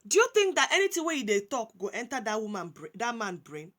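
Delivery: ordinary talk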